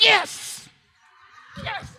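high, jubilant yes shouted out; cheering his son on at the ball game; excited cheer. Retold or imitated. Father cheering his son's tag at the game, jubilant. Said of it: "I was like".

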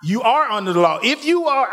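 high, fervent preaching climb. Acted. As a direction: build the sermon to a peak. Preaching rises again.

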